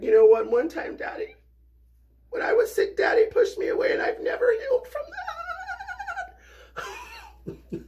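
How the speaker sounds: put-on very high, crying, squeaky wail of a wounded child; dramatic kid. Dad mocking his grown kids' dramatic complaint, high crying wail. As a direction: sob it out like an overdramatic child. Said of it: "my kids are dramatic".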